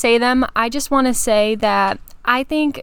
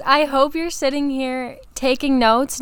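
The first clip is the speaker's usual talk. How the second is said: ordinary talk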